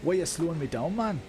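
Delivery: hammed-up Geordie gripe; mock Geordie accent. Mock Geordie complaint to slow racers.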